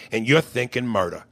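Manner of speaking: ordinary talk, nothing marked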